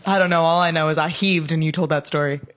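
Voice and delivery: ordinary delivery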